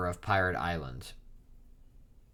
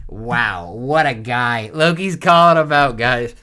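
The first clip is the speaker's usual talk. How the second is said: much louder and higher, mock-outraged exclamation; excited outcry; 3 semitones higher, 14 dB louder, slower than usual. Real, not acted. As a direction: shout it out as if scandalized by the character. Mock outrage at manga character.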